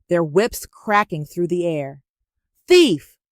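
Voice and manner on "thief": much higher, much louder accusing shout. A narrator's reading. Masquerades accusing Nonso, a loud shout. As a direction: hurl the word at him. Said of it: "they shouted".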